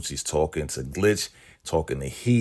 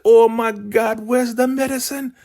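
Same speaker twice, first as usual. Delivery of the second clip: high, loud alarmed cry; shrill-voiced mock panic as Elder Mother; panicked; 6 dB louder than usual. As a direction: panic at the missing medicine. Elder Mother finds medicine gone.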